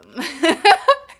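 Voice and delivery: high, loud cracking-up laugh; loud burst of laughter. Real, not acted. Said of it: "Sounds really goofy".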